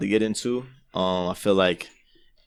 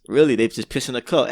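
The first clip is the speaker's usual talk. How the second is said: ordinary delivery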